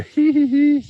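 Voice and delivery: sarcastic fake titter; squeaky mocking giggle. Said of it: "I'm supposed to just giggle".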